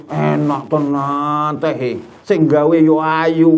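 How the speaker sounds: slow, drawn-out admiring husband; husband fawns over the tea stall's tea, hammed-up and slow; mock flirty praise. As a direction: savour every word of the praise slowly. Husband praising warung tea lady.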